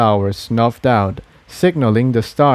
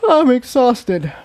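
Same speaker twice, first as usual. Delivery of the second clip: much higher, slow tired filly voice; sleepy; 12 semitones higher, slower than usual. A narrator's reading. Tiptoe tells friends she is exhausted, much higher and slow. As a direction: drag it out, worn out.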